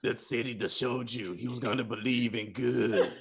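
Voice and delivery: very low, hushed superhero rasp; Batman. Acted. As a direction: do a mock Batman, deep and quiet. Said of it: "I thought he was gonna be like".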